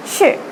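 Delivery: sure falling sharply, like a statement; fourth tone. Shown as a demonstration. Teacher demonstrating the falling fourth tone. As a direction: drop sure straight down. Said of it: "the fourth tone is going downwards".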